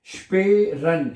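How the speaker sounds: स्पेरन slowly, "shpay" held, then "ren"; slow sounding-out. Shown as a demonstration. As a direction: split स्पेरन into two syllables. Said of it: "ये आपका बनेगा".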